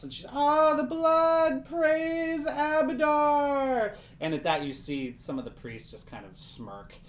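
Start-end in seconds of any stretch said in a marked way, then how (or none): 0.3-3.9 high, loud, long-drawn rapture; ecstatic cry. Acted. Old woman praising her god as she drinks blood, ecstatic. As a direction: wail it out slowly in religious ecstasy. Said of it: "like, an ecstatic experience".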